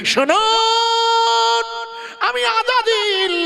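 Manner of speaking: high, very slow stretched cry; drawn-out high cry. Acted. Satan announcing himself to the Prophet, high stretched cry. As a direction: stretch each word into a high wail.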